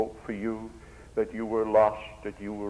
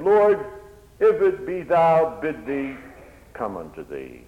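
fervent plea; 4 semitones higher, 6 dB louder than usual. Acted. Peter cries to Jesus on the water, loud then fading.

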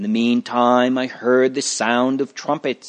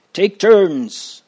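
higher-pitched call to order, mildly yelled; restrained yell; 8 semitones higher, 4 dB louder than usual. A narrator's reading. Judge to a clamouring jury, restrained yell. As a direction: call out an order, short of a shout. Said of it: "the judge yelled".

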